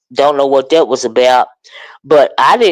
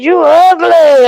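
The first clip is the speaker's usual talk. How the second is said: slow, high, loud mimic of the insult; mock insult; 12 semitones higher, 5 dB louder, slower than usual. Streamer retelling a panel clash, mock slow squeal. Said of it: "Honest Rowe kept saying".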